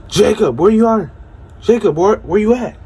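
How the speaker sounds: high, loud anxious calls into the woods; calling out. Retold or imitated. Friend calling into dark woods for missing Jacob, loud and high. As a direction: shout his name across the trees.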